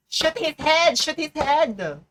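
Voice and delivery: shouting. Real, not acted. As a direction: bark the order at the game. Yelling at the game.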